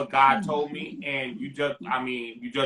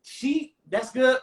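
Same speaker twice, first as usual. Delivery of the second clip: higher-pitched mimic of the pastor's gentle no; imitating the pastor; 7 semitones higher than usual. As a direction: play the pastor letting him down easy, pitched up. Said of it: "That's how he said it".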